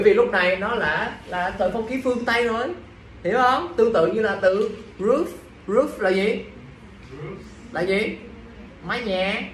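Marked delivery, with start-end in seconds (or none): none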